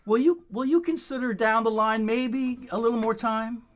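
ordinary talk, nothing marked

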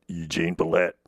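low, forced character voice; Eugene Pallette. Shown as a demonstration. Voice teacher reaching for that low, low character voice. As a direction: push down into a low register. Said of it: "It's hard to get that low".

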